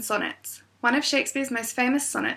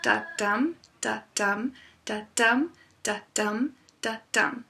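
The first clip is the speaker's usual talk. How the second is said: five "da-dum" feet, each "dum" stressed and rising; a steady run of "da-dum" with the stress on every "dum"; iambic pentameter beat; slower than usual. Shown as a demonstration. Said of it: "five iambic feet in a row".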